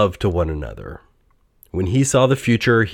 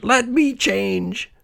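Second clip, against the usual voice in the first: slow, high desperate plea; Scrooge; 14 semitones higher, much slower than usual. A narrator's reading. Old miser begs the phantom at his grave, slow high cry. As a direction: stretch the plea out, pitched high. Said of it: "Scrooge cried".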